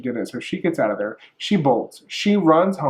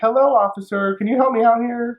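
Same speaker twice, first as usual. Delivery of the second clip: polite to police; 8 semitones higher than usual. Pretend. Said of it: "I would've been like".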